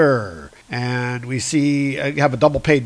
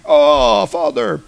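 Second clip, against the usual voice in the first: acted startled cry, higher pitched; mock cry out; 7 semitones higher, 4 dB louder, slower than usual. Hercules falls backward calling to his father, mock cry. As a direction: call out as you are knocked backward.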